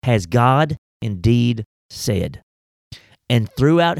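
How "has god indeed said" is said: Has God indeed said drawn out slowly; slow each word out like scripture; slow, deliberate quote. Shown as a demonstration. To listeners, slowly quoting the serpent's question. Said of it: "The first question of the Bible".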